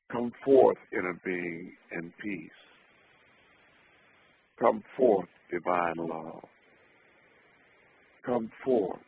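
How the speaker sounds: commanding call, then softening; solemn invocation. Acted. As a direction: summon it with conviction. Invocation chant, repeated.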